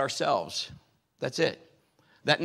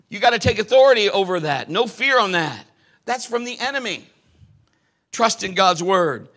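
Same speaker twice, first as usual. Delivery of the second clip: loud, rising preacher's cry; full-force preaching; 4 semitones higher, 11 dB louder than usual. Acted. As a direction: preach it hard to the congregation. Preaching healing scripture at full force.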